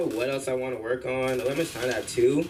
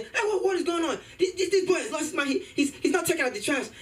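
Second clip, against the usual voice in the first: much higher hammed-up dad voice; outraged dad. Dad storming in about the trash, high hammed-up outrage. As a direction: play the dad overreacting in a high voice.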